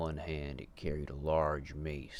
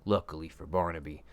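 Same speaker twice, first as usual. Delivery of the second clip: usual speaking voice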